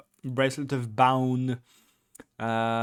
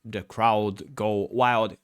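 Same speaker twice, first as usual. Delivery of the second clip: ordinary talk, nothing marked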